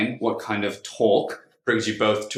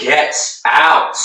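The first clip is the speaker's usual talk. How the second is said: louder, sharp furious order; angry shout; 3 semitones higher, 7 dB louder than usual. Acted. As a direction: bark her out of the room. Throwing her out angrily.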